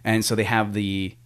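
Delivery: ordinary talk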